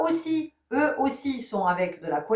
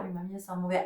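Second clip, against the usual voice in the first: put-on soft, meek child's complaint; small child's whine; 8 dB quieter, much faster than usual. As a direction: protest quietly like a little kid. Child protesting again.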